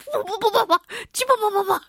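silly nonsense syllables through giggles; giggly nonsense. Real, not acted. Host babbling silly syllables while laughing at himself.

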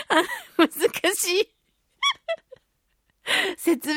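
laughing through the words, pitch jumping high; amused, words breaking into laughter. Real, not acted. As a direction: giggle out the complaint helplessly. Laughing at failing to explain.

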